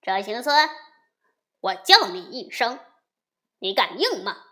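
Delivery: loud, scornful dare; loud contemptuous taunt; dismissive. A narrator's reading. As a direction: sneer the challenge at him. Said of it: "银角不以为然地说".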